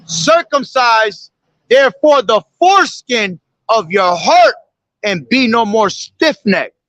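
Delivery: solemn measured recitation of the verse; scripture reading. Acted. Preacher reciting scripture to his listeners, solemn. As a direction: read it out like holy writ.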